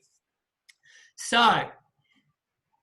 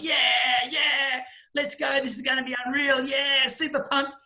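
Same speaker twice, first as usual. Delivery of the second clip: higher, pumped-up mock excitement; fake hype; 5 semitones higher than usual. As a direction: act falsely stoked on the drive. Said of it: "you're not really feeling that way".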